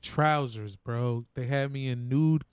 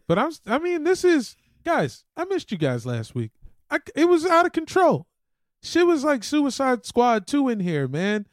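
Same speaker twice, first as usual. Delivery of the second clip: higher and louder, rushing with excitement; excited rant; 9 semitones higher, 4 dB louder than usual. Real, not acted. Telling the guys how wild last week got, excited and loud. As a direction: pile it on, it was chaos.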